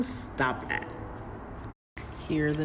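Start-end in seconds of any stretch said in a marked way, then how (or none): 0.0-0.9 hissed under the breath; whispered scolding. Real, not acted. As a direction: shush them quietly. Scolding the teasing partner.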